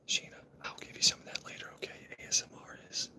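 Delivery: teasing ASMR whisper; mock ASMR whisper. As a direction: breathe it close like an ASMR clip. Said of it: "it's not about A S M R".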